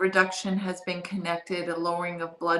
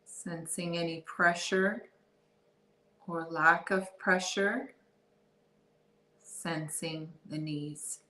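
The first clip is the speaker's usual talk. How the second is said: calm, softer body-scan voice with long pauses; guided meditation; 3 dB quieter than usual. Real, not acted. Guide leading listeners through a body scan, guided meditation. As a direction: ease them into awareness, unhurried and soft.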